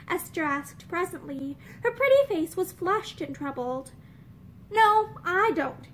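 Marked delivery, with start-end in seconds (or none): 4.7-5.9 slow, firm, every word weighted; emphatic. A narrator's reading. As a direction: plant each word firmly. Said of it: "said June emphatically".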